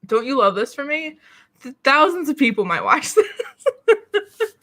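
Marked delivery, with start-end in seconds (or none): 1.6-4.6 laughing. Real, not acted. Laughing at own coughing.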